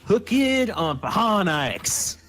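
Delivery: slow, loud announcer voice; hammy sponsor announcement; mock ad read.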